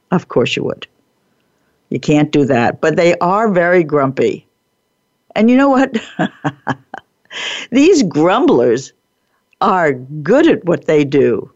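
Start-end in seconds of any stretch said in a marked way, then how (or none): none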